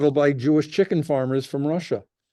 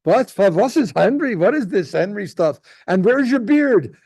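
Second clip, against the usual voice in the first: mother's voice; 7 semitones higher, 4 dB louder than usual. Retold or imitated. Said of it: "And she says".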